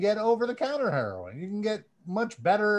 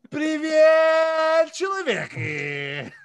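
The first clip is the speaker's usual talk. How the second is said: mock Russian greeting; 7 semitones higher, 6 dB louder, much slower than usual. Hailing the listeners with a hammy Russian hello. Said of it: "Somehow it sounds worse in Russian".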